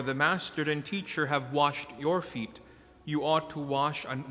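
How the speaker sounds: normal talking voice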